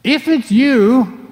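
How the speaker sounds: 3 lightly voiced Peter, unsure; 4 drawn-out hopeful appeal; 1 Peter calling out. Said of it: "He said".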